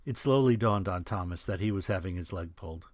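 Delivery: ordinary talk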